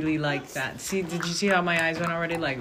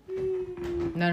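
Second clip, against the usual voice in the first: a sudden squeaky high "No"; sharp high no; 13 semitones higher, 5 dB quieter than usual. Real, not acted. Stopping the group's squint, a sudden high no. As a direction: jump way up in pitch to stop them.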